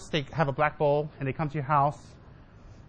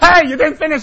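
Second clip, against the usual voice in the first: mock shout; 13 semitones higher, 16 dB louder than usual. Calling after a student who quits.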